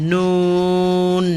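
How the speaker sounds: নুন drawn out with a hum in the nose; lightly nasal. Shown as a demonstration. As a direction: hold নুন long and tuck it lightly into the nose. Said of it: "নাকের ভিতরে হালকা একটু লুকাবে".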